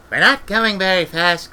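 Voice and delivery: put-on high meek child's voice; timid little girl's remark. Esk meekly telling Granny on the broom, high and timid. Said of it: "said Esk meekly".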